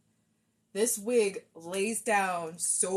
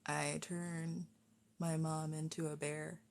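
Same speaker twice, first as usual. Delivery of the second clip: working out the line; 5 semitones lower, 9 dB quieter than usual. Shown as a demonstration. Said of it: "hear the voice in my head".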